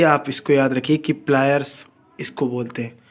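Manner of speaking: ordinary talk, nothing marked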